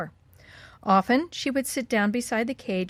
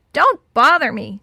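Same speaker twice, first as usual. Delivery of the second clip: loud, higher, harsh squawk; very harsh parrot; 4 semitones higher, 8 dB louder than usual. A narrator's reading. Parrot rejects Betty's plea, very harshly. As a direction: snub them in a harsh parrot voice. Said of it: "said the parrot very harshly".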